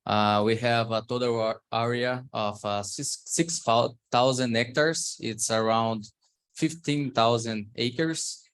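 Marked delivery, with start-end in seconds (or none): none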